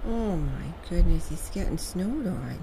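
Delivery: loud, carrying call across the yard. Real, not acted. Owner calling loudly to her bird in the snow.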